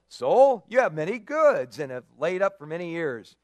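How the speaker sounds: loud, grand announcement; proclaiming to his soul. Acted. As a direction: call out to your own soul triumphantly. Rich man addresses his soul.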